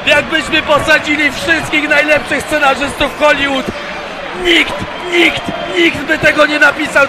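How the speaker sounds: loud, high goal celebration; ecstatic shouting. Real, not acted. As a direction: yell it out in pure joy. Celebrating the winning goal live.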